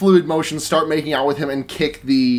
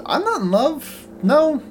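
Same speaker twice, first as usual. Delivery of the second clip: mock high girl voice; 10 semitones higher, slower than usual. Voicing Tree's reply.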